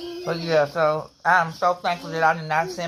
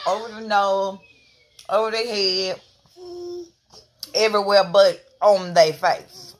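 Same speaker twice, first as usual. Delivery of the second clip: ordinary delivery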